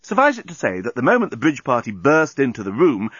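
usual speaking voice

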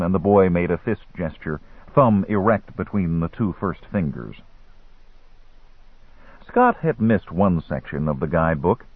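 plain reading voice

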